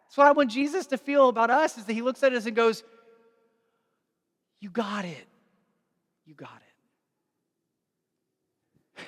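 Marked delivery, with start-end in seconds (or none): 4.6-9.0 softer, fading almost to a breath; tender and quiet. Retold or imitated. Jesus looking at us, quiet and tender. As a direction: say it gently, like a proud parent.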